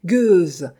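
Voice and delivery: loud, acted insult hurled out; furious cry. A narrator's reading. Widow lunging at Sophie, crying out furiously. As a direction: explode on one word in rage. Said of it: "cria-t-elle".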